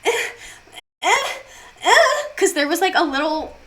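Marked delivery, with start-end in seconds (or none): 0.0-2.2 high, loud petulant 'eh' noises; mock irritated grunts. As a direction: imitate a fussy swatting grunt. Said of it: "the fucking pen swatting, he was like".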